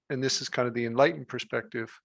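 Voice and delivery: usual speaking voice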